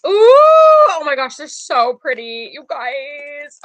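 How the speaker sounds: high, loud delighted ooh; thrilled unboxing squeal. Real, not acted. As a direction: squeal at something pretty. Unboxing the eyes.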